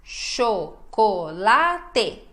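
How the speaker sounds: CHOCOLATE in four separate syllables, cho, co, la, te. Shown as a demonstration. Teacher dividing the word chocolate for learners, syllable by syllable. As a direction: break chocolate into slow, full syllables with gaps. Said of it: "A gente vai dividir essa palavra".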